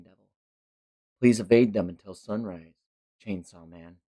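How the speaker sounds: low, soft ominous pronouncement; falling devil. Acted. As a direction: intone it like a menacing host. Devil proclaiming rules.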